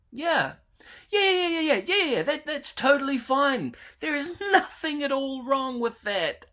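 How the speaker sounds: high, sarcastic rapid yeahs. Real, not acted. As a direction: pretend everything is fine, sarcastically. Sarcastic reaction to plot.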